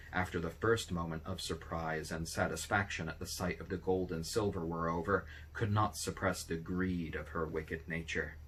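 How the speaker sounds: plain reading voice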